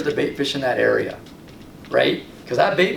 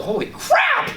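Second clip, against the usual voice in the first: high squeaky yelp of disbelief; a startled, high-pitched holy crap; shocked exclamation; slower than usual. Retold or imitated. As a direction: blurt it out in sudden amazement. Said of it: "And I was like".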